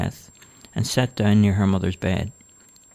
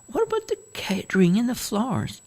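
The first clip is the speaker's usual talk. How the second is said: put-on old mother voice. A narrator's reading. Mother questions wedding plans.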